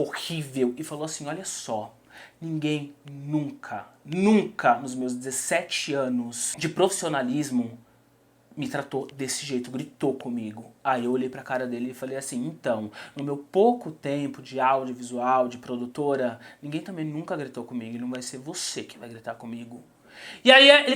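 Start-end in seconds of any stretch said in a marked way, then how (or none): 1.3-9.7 low, slow and hushed retold reproach; quiet confrontation. Director in her face, menacing. 12.5-19.8 low, soft, measured comeback; controlled defiance. Retold or imitated. Controlled reply to director's face.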